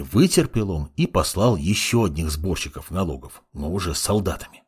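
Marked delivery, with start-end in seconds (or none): none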